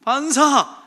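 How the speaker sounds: high, drawn-out retort; playful comeback. Acted. Father repeating the comeback for listeners, playful drawn-out retort.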